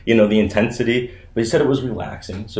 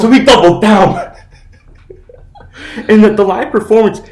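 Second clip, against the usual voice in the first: loud laughing, higher voice; 11 semitones higher, 10 dB louder than usual. Real, not acted. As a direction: crack up mid-sentence, loud. Laughing, louder story climax.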